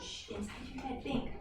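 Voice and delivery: soft hushed reminder. Real, not acted. Teacher hushing a child.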